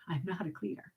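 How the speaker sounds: hushed. Real, not acted. Hiding the admission from her husband at home, hushed. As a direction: say it so the husband won't hear.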